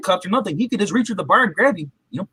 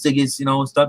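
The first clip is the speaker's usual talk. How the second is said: ordinary talk, nothing marked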